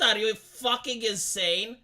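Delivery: ordinary talk, nothing marked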